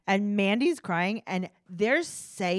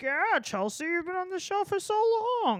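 a hint of a dopey dad, pitched up; mock dad voice; 9 semitones higher than usual. Podcast host imitates the dad answering his daughter, lightly mocking. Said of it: "And he's like".

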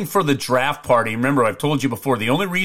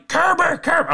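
high, squawked name-calling; imitated parrot-like heckler; bad-voiced parrot. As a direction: keep pestering in a parrot screech. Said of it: "sounded like a, like a, a parrot that had a bad voice".

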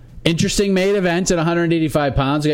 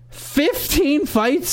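disbelief; 13 semitones higher, much slower than usual. Real, not acted. Shocked by card length.

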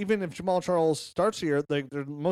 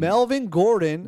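hype announcer; 5 semitones higher, 7 dB louder, slower than usual. Pretend. Hyping up a star player.

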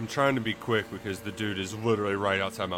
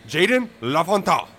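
mock-French flourish on the name, higher and louder; French accent; 6 semitones higher, 6 dB louder than usual. Shown as a demonstration. Co-host unveils the new recruit to his partner, French accent. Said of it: "say it with a French accent".

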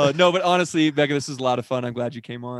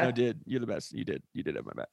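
hushed mock sincerity; 6 semitones lower, 12 dB quieter than usual. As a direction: voice dropping low and soft. Mock-sincere thanks, quiet.

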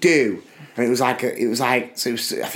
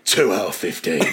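very low hard-man teacher voice; Jason Statham; 9 semitones lower than usual. Retold or imitated. Teacher handing back a failed test, mock hard man. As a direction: give the mark as a deep action-hero verdict. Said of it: "Like Jason Statham".

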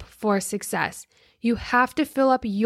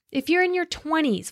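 ordinary talk

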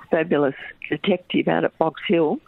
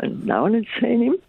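teary; 6 semitones higher than usual. Real, not acted. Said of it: "Sorry, I'm a bit teary".

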